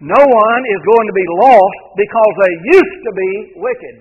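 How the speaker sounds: emphatic repeat. Shown as a demonstration. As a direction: drive the point home to the congregation.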